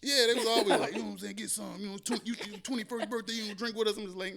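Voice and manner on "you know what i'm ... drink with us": family voiced. Family voiced urging him drink.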